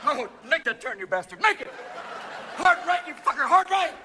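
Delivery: high, loud frantic commands; urgent steering yells. Acted. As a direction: yell directions down the track. Acting out two-man luge race.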